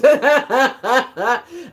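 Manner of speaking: real loud, rolling laugh; distinctive laugh. Real, not acted. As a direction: burst out in a long cackling laugh. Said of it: "that's an interesting laugh you got there".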